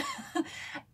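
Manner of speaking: airy squeal of a laugh; real giggle at her own joke; breathy high laugh. Real, not acted.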